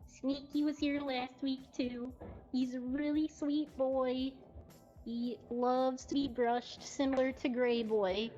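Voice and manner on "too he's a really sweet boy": ordinary talk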